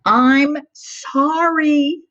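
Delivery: storybook wail, lightly acted; crying apology. A narrator's reading. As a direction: plead it like a tearful child. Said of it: "Harriet cried".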